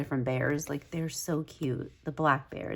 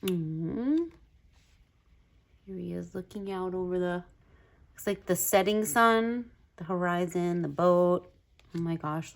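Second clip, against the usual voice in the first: ordinary talk, nothing marked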